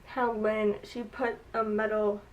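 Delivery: normal talking voice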